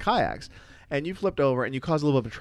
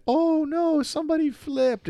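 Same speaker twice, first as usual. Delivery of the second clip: mildly scared gasp, much higher; 14 semitones higher, slower than usual. Retold or imitated. Nervous girl on the river seeing a flip, mildly scared and much higher. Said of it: "kind of a scared".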